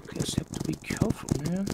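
whispering. Acted. Hushed warning mid-battle.

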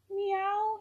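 a high, loud cat's meow; calling meow. A narrator's reading. Barn cat calling down at the hedgehog, high loud meow. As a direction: meow down from the tree. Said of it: "he called down".

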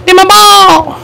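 ordinary delivery

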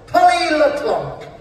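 slow, grand self-announcement; drawn-out name. Acted. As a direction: savour the surname slowly. Announcing her own name grandly.

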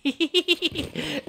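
quick high-pitched snicker; playful squeaky tittering; high giggle. Pretend. Giggling over the destruction.

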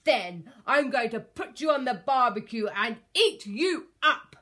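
loud dinosaur menace; greedy yelling. A narrator's reading. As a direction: bellow it, relishing every bite. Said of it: "yelled the Tyrannosaurus Rex, dribbling greedily".

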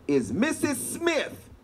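normal talking voice

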